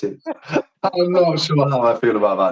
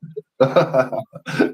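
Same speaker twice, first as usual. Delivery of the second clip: amused laugh, raised in pitch; 4 semitones higher than usual. Real, not acted. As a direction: laugh heartily at the banter. Laughing at the refund offer.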